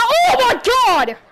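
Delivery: loud, high-pitched exclamation; startled outburst. Real, not acted. Gamer reacting to a shock in the game, startled outburst. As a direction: blurt it out loud and high, laughing.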